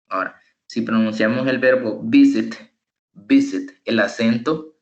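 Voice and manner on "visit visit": "visit" with the force on VI; VI-sit, second syllable light; first-syllable stress. Shown as a demonstration. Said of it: "la fuerza la tiene en la primera sílaba".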